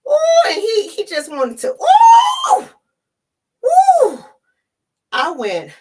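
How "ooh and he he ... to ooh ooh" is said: very high, loud whoops; excited squeals. Real, not acted. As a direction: squeal with glee. Excited squeals about the meeting.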